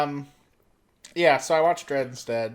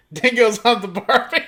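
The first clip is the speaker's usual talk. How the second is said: higher, louder echo of the bad Aussie accent, laughing; mock Australian accent; 7 semitones higher, 5 dB louder than usual. Co-host copies the joke line in mock Aussie accent. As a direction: parrot the mock Aussie line through giggles. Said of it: "do Australian".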